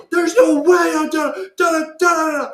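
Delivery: high, loud reenacted scream; screaming monologue. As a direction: scream the complaint at the top of your lungs, words dissolving into noise. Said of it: "screaming at the top of my lungs".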